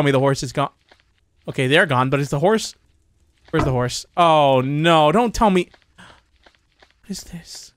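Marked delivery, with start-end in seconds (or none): 6.0-7.7 a frightened, breathy whisper. Real, not acted. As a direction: whisper it, creeped out. Creeped out by the scene.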